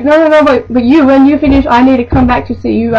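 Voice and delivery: cling to the one worker she trusts; mock customer insisting to the coworker; insistent customer impression.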